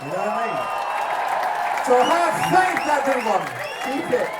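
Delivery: loud, high rallying preacher cry; mock sermon. Singer rallying the audience to have faith, loud mock preaching. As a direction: whip the crowd into hopeful faith.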